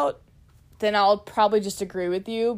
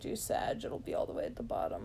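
whispering; 13 semitones lower, 13 dB quieter than usual. Real, not acted. Quiet aside about the quiz answer.

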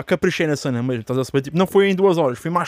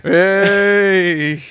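sudden loud teasing "hey"; excited exclamation; 4 semitones higher, 6 dB louder than usual. Real, not acted. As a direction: call it out, loud and playful. Teasing reaction to friend's boast.

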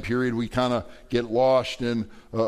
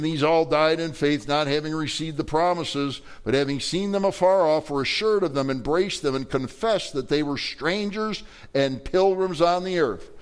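scripture reading; 4 semitones higher than usual. Acted. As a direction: read it out to the congregation like holy writ. Reads scripture verse aloud.

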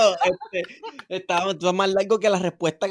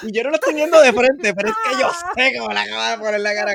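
high, loud, fast talk through laughter; bubbling over while picturing his face; laughing; 7 semitones higher, 8 dB louder than usual. Real, not acted. Picturing the friend's face, high, loud, laughing.

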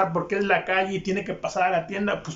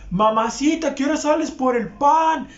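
higher, louder catcall; hammed-up sleazy catcall; mock catcall; 7 semitones higher, 4 dB louder than usual. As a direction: call out like a street creep. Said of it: "le dices".

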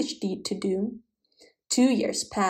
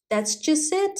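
higher-pitched, lightly tearful reading; understated storybook sob; mild mock sob; 6 semitones higher than usual. A narrator's reading. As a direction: narrate a princess's sob without really crying. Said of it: "sobbed the princess".

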